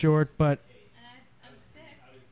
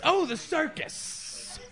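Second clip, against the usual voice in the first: much higher, slow, exaggerated; high drawn-out oh; 13 semitones higher, much slower than usual. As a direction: stretch it up high, theatrical.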